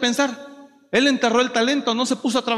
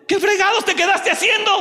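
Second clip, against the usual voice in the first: angry parent; 8 semitones higher than usual. Retold or imitated. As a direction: imitate a fed-up parent back from work. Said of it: "Y usted se enoja y dice". (padre enojado regañando, más agudo y fuerte)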